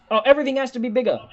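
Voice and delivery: fast mocking quip; mocking bigger-is-better. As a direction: rattle it off mockingly. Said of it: "But I've yelled enough".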